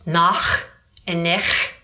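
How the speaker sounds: nach and nech with throaty German ch, slow; guttural German ch sounds. Shown as a demonstration. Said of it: "if we use the word".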